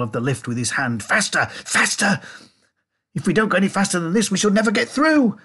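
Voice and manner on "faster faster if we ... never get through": high, loud, racing demand; frantic, pitched-up urging; urgent cry. A narrator's reading. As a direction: holler impatiently for more speed. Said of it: "cried Mister Wonka, banging the wall".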